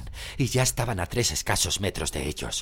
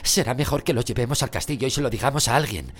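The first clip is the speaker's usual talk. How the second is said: higher, louder urgent character voice; urgent suggestion; 8 semitones higher, 5 dB louder than usual. A narrator's reading. Harry urging a friend to head for the castle, urgent. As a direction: press the plan on a friend.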